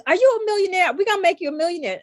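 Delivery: an eager cheerleader pumping her up; excited hype. Retold or imitated. Laura hyping Gigi as she walks past, a high excited tease. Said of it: "she was funny".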